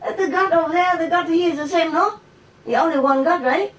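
high, incredulous protest; squeaky disbelieving retort; surprised acquaintance. Retold or imitated. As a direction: voice him high and astonished. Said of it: "acquaintance was very surprised".

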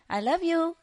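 high-pitched pet bird imitation; bird's love plea. Bird asking for peanuts, high mimicked voice. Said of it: "he keeps saying".

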